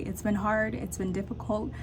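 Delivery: normal talking voice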